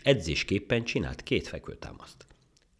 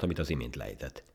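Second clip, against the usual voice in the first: usual speaking voice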